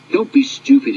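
stern driver. Acted. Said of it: "his driver said".

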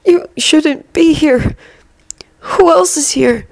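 high, laboured voice of an injured professor; slow, pained speech. A narrator's reading. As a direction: speak slowly as if it hurts. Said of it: "the professor spoke slowly, as if it was hurting him".

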